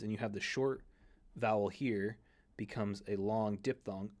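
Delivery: ordinary talk, nothing marked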